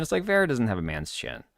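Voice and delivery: ordinary delivery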